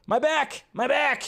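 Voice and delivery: high-pitched cry of mock agony; hammed-up high complaint; mock back pain. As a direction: yelp it high, as if the back gave out. Voicing woman posing in photo.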